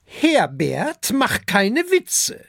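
high, loud rebuke to her husband; female dragon scolding. A narrator's reading. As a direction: tell him off in a high she-dragon voice. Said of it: "sagt die Fressdrachin". (hohe, tadelnde Drachinnenstimme)

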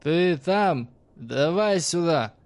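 loud, slow slurred shout; drunk shout. A narrator's reading. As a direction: yell it drunkenly across the tunnel. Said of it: "крикнул кузнец".

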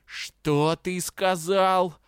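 slow, drawn-out angry hiss in a changed voice; menacing hiss. A narrator's reading. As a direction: hiss it slowly through bared teeth. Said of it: "прошипел обозлённый кентавр". (злобное медленное шипение)

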